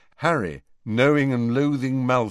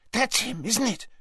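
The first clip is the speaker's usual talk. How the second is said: ordinary delivery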